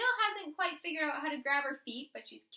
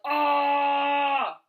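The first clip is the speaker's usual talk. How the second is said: loud pained roar; gorilla yell; 12 dB louder than usual. A narrator's reading. Gorilla bellowing after the baby bites its nose. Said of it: "The gorilla yelled".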